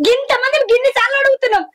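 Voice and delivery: ordinary talk, nothing marked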